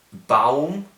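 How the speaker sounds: ordinary talk, nothing marked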